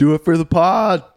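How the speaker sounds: high, loud cheering call; shouting encouragement. Real, not acted. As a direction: egg her on loudly. Egging Millie on climbing.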